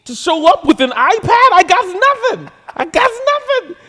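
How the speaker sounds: high, loud, fed-up rant at the panel; exasperated shout. Retold or imitated. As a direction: shout it out like a comic roasting the room. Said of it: "I look out to the crowd and I say".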